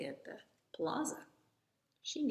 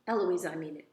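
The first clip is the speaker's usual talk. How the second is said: louder mimicking shout, not full force; narrated shout; 10 dB louder, faster than usual. A narrator's reading. Eloise shouting back right after her tutor, moderate shout. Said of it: "And then I shout".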